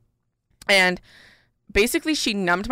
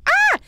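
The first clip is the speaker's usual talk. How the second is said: sharp, much higher yelp as if hurt; retold yelp of pain; 6 dB louder than usual. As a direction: let out a sudden pained "Ah!" at the nail place. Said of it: "And I was like".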